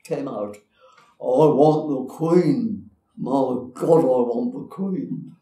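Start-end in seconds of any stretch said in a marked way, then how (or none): 1.2-5.4 put-on loud, slow drunken bellow; a boozy man shouting slowly into a silent crowd; drunken beery voice. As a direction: bellow it like a drunk breaking the hush. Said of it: "a very drunken, beery voice".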